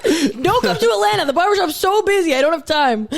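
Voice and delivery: mock-complaining uncle, pushed high and loud; imitating busy uncle Gino. Uncle brushing off New York family, hammed-up high and loud. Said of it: "He tells the family up in New York".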